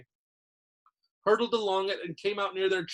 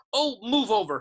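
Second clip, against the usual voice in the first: higher, louder impatient snarl; 7 semitones higher, 6 dB louder than usual. A narrator's reading. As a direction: shove them aside with your voice. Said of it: "Hermione snarled".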